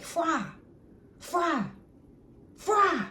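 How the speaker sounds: high, punchy mock call, spaced out; imitated man's call. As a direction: imitate a father calling out a nickname. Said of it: "a deep grown man voice".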